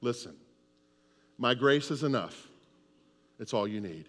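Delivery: quiet, gentle reassurance from God; calm voice of God. Acted. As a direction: speak low and soft as God comforting. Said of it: "what does God say?".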